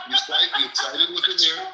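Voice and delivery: play-scold her while cracking up; laughing mock accusation. Mock-dumping Faya for siding with Amir, laughing.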